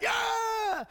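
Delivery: high, drawn-out victory yell; cheering fans. Acted. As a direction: whoop like a crowd after a basket. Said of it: "Fans are cheering".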